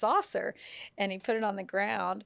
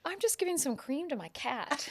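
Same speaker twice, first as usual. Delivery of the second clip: innocent explanation; 8 semitones higher than usual. Retold or imitated. Punchline, Washington explaining himself.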